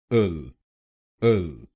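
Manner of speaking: इल said low and short; syllable demo. Shown as a demonstration. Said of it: "को हम बोलेंगे".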